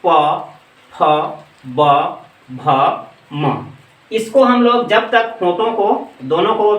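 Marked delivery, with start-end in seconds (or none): 0.0-3.7 प, फ, ब, भ, म each said apart with the lips pressed together; lip sounds demo. Shown as a demonstration. Teacher sounds out प फ ब भ म for students, spaced lip demo. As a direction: sound out प फ ब भ म one by one, lips closing. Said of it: "अब इसको बोल के देखते हैं".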